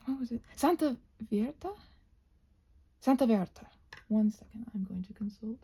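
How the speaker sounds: usual speaking voice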